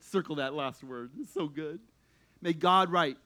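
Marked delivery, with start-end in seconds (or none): none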